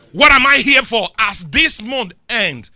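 loud, slow, drawn-out preacher cadence; preaching. Real, not acted. Preacher driving the congregation toward the closing scripture, loud and slow. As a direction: preach it over the music.

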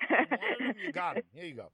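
mocking jab. Mocking the progressives' wish.

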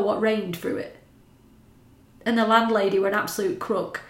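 ordinary talk

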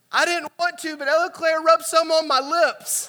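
put-on high little girl voice, laughing. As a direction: play the innocent little kid. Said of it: "She's like".

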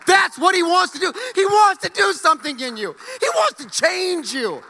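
high, loud and cracking with excitement. Real, not acted. Pastor's voice cracks in excitement about change. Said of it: "when my voice starts cracking like I'm going through puberty".

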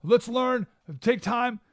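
high, pleading imitation of scared advisors; hammed-up panicky begging; mock fearful advisors. Scared advisors pleading with the new king, high mock fear. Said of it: "They're fearful".

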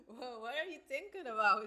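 laughing through the question; words bubbling with real laughter. Real, not acted. Laughing at a slip.

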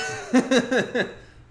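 loud, high burst of laughter; real laugh that bursts out. Real, not acted. Host reacting to a co-host's deadpan oops, laughing.

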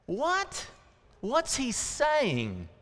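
squeaky high, hushed "What?"; baffled disciples. Retold or imitated. Disciples confused by Jesus's words, high and hushed. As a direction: play the disciples puzzled and at a loss.

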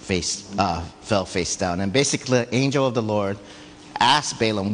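usual speaking voice

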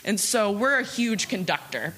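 usual speaking voice

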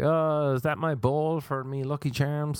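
hammed-up fake Irish accent, pitched high; fake American Irish. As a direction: do a dumb stage-Irish accent. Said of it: "Something dumb Irish, fake Irish, American Irish".

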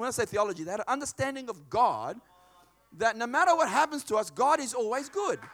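normal talking voice

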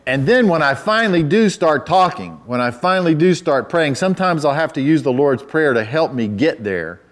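normal talking voice

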